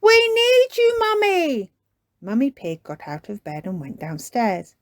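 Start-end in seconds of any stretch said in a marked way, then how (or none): 0.0-1.7 calling out. Acted. Calling Mummy downstairs.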